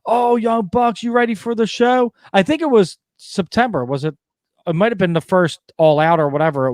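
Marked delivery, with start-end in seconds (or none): 0.1-2.1 high eager fan voice; excited kid fan. Retold or imitated. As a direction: gush like a young fan. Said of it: "and the kid goes".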